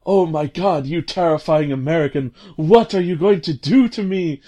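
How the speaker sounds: high, hammed-up frightened cry; mock terror. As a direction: play the scared heroine, overdone. Helena's frightened line.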